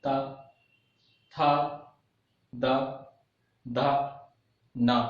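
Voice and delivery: ता, था, दा, धा, ना as single, separated syllables; syllable drill. Shown as a demonstration. Teacher asks students to say dentals, syllable drill of ता, था, दा, धा, ना. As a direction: recite the dental row one sound at a time, ता to ना. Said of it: "आप बोलिए".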